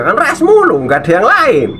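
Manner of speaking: exaggerated incredulous rise; mock exasperation. Real, not acted. Teasing the English speaker, mock exasperation. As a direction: pitch jumps high on mulu. Mocking one word for all.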